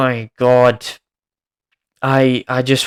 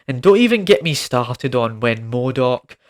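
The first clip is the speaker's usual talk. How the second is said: normal talking voice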